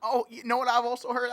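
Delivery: ordinary talk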